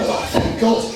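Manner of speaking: ordinary talk, nothing marked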